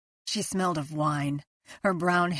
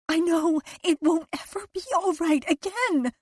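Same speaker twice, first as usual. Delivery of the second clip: higher, rising to anguish; guilt-sick despair; 6 semitones higher than usual. A narrator's reading. Liz to Owen about lying to Carol, anguished and higher. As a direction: ache with guilt.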